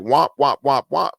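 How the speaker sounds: droning muted-trumpet "womp, womp"; Charlie Brown's teacher. Retold or imitated. Describing his own monotonous voice, mock muted-horn drone. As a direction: mimic a teacher's voice as a wah-wah horn. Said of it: "like Charlie Brown's teacher".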